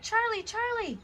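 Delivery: high calling out a name. A narrator's reading. Tom calling for Charlie in the garden. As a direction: shout after him, searching.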